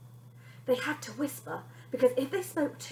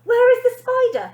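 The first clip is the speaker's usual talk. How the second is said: higher, louder asking; urgent question; 12 semitones higher, 11 dB louder than usual. Acted. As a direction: demand where the spider is. Father asking loudly.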